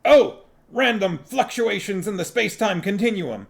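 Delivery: high, loud cry of an atheist swearing; shouted oath. A narrator's reading. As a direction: shout it out like a curse. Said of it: "and shout".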